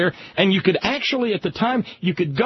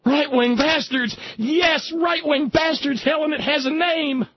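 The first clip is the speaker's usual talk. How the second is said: higher, shouted, slow triumphant realisation; mock breakthrough shout; 8 semitones higher, slower than usual. Imagined right-wingers finally naming themselves, shouting in triumph. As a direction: cry it out like Helen finally getting it. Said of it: "until they go".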